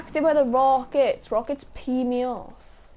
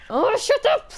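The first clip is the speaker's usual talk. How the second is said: high, irritated cry; exasperated outburst; 12 semitones higher, 4 dB louder than usual. Real, not acted. Player interrupted mid-rant, exasperated high outburst. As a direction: cut in with a high-pitched protest.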